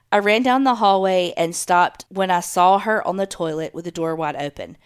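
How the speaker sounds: ordinary delivery, nothing marked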